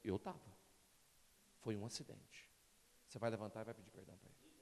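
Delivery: low, quiet, firm reply; Espírito Santo. Retold or imitated. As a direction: imitate a calm authority correcting someone softly. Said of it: "O Espírito Santo falou". (resposta firme encenada, em voz baixa e grave)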